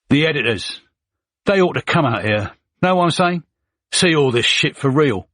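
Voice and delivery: Cockney soldier venting at editors; Cockney accent. Acted. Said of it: "in a Cockney accent".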